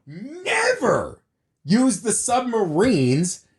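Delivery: high-pitched, drawn-out exasperated yelling; yelling rant. Real, not acted. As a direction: rant it slowly at a high pitch, stretching key words. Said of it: "pissed 'cause I'm yelling".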